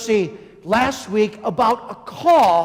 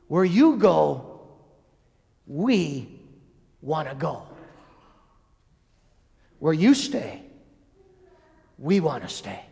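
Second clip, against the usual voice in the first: tender plea; 6 semitones lower than usual. Retold or imitated. Disciples' plea to Jesus.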